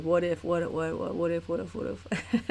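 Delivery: lightly imitated twitchy what ifs; Ed Grimley. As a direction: mimic a frantic stammer. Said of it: "become Ed Grimley".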